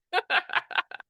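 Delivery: high burst of real laughter. Real, not acted. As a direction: laugh at the botched joke. Laughs at failed joke.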